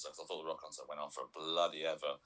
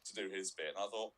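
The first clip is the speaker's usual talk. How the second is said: normal talking voice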